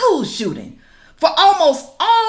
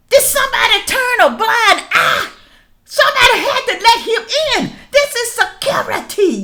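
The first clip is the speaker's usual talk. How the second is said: outraged shout; 9 semitones higher than usual. Real, not acted. Vlogger shouting at viewers about the security, outraged shout. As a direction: demand answers at full voice.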